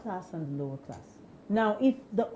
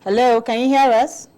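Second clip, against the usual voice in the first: much louder call down the line; 3 semitones higher, 8 dB louder than usual. Real, not acted. Host trying the silent caller once more, calling out. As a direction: project it to someone who isn't answering.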